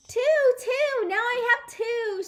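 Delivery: very high, loud gloating holler; triumphant holler. Acted. May gloating to Sheldon, triumphant holler. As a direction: crow it in triumph. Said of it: "she hollered".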